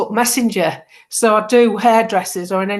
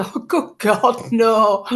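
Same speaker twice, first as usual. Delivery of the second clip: slow horrified groan; much slower than usual. Retold or imitated. Horror at learning the truth.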